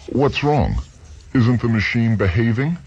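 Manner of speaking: sharp, high alarmed question. Acted. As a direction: George snaps to attention, worried.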